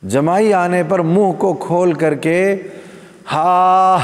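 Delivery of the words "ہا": a drawn-out, open-mouthed yawn sound, higher; yawning haa sound. Shown as a demonstration. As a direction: show the loud haa of a careless yawn. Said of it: "یا ہا ایسی آوازیں نہ نکالے". (جمائی کی ہا کی آواز)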